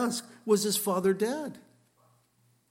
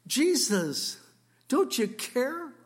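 incredulous objector; 5 semitones higher than usual. Pretend. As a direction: plead the skeptic's case, pitch up, slowed. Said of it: "you say".

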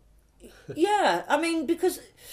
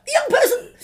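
high, loud delighted squeal; excited squeal; 9 dB louder than usual. Retold or imitated. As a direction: squeal it with glee. Delighted reaction to young audience member.

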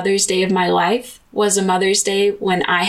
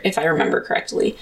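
normal talking voice

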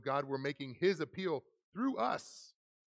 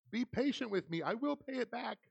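raised pitch, begging tone in a lightly voiced servant; pleading servant; 10 semitones higher than usual. A narrator's reading. Servant begging the master for time, pleading higher. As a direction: beg for mercy on your knees. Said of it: "begged him".

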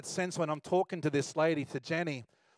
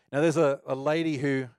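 usual speaking voice

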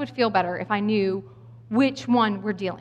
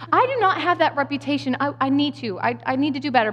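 ordinary talk

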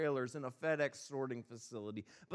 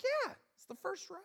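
much higher, lightly voiced wife; wife insists it's the first right, in a high mock voice. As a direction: squeak it out like his wife. Wife's retold reply.

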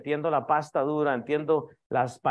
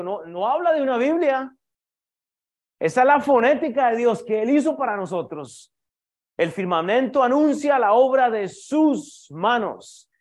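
preaching at full force; 4 semitones higher, 5 dB louder than usual. Acted. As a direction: build it up like a revival sermon. (predicación a todo pulmón)

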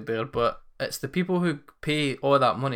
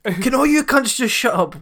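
much higher, louder exasperated yell; angry shout; 10 semitones higher, 8 dB louder than usual. Acted. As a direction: silence the whole cinema. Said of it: "quite a good wee skit".

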